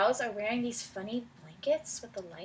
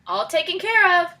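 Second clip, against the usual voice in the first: higher, louder, proudly pleased; 7 semitones higher, 9 dB louder than usual. Acted. Bob proudly reporting to Farmer Brown on the phone. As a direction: announce it like a job well done. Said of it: "says Bob proudly".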